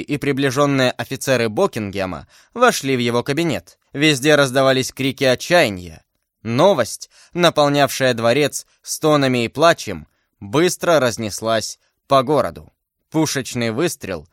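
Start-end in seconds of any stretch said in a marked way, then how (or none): none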